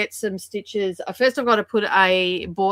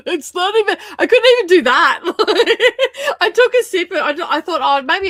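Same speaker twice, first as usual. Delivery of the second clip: high laughing, much louder; 10 semitones higher, 9 dB louder than usual. Real, not acted. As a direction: laugh through the words. Laughing retelling bad coffee.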